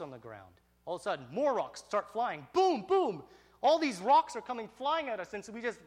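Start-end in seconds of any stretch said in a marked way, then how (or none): 2.5-3.2 loud, high imitated booms; booming impact noises. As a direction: punch out two sudden crashes. Imitates rocks landing.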